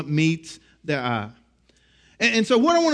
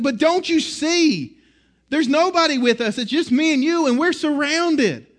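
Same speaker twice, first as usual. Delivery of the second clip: high-pitched, pleading protest of a worried servant; alarmed servant; 7 semitones higher than usual. Acted. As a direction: act out a servant's disbelief, pitched well up. Frightened servant protesting to Elisha.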